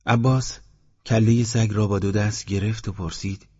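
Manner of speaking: ordinary delivery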